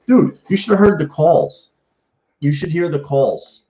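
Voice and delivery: sudden high excited burst. Real, not acted. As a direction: leap up in pitch, thrilled. Excited about rival fan calls.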